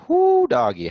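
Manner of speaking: high, loud whoop of amazement; exclaiming. Acted. Teacher reacts to spelling a long word, exclaiming. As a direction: whoop at how big the job looks.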